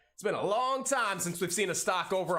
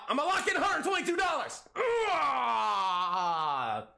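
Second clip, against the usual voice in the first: much higher, then a joyful yell; excited; 7 semitones higher, slower than usual. Real, not acted. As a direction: celebrate locking in the profit. Closing a winning trade.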